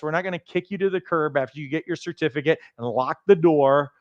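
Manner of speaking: ordinary talk, nothing marked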